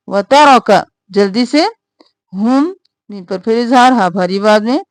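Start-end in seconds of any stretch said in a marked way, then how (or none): none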